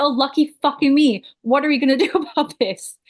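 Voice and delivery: sarcastic. Retold or imitated. Sarcastic reaction to snapped line.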